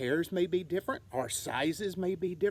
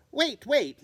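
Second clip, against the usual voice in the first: higher, louder urgent wait; urgent call; 7 semitones higher, 6 dB louder than usual. Acted. Mother bat pushing through the crowd, calling urgently.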